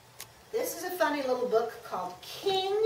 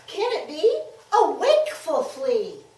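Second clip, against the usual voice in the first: high, loud gasp of surprise; 5 semitones higher, 8 dB louder than usual. A narrator's reading. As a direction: act out a startled discovery.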